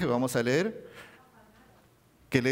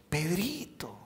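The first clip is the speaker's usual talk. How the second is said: softer, tender Pedrito; affectionate diminutive; 3 semitones higher, 5 dB quieter, much slower than usual. Shown as a demonstration. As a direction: say the nickname fondly. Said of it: "en nuestro español que decimos". (diminutivo cariñoso y suave)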